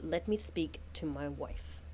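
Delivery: low, slow, hushed command; low quiet noble. Acted. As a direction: drop low and still, deep in thought. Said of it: "she goes".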